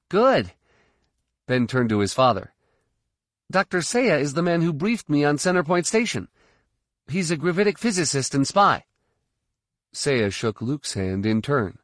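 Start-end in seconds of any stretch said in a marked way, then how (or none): 0.1-8.8 put-on teen boy voice. A narrator's reading. Ben's voice.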